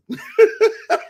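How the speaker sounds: loud, high cackling laugh. Real, not acted. Friends joking about fake cologne, loud laugh. Laughs at losing his smell.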